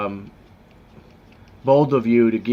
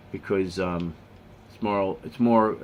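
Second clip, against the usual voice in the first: usual speaking voice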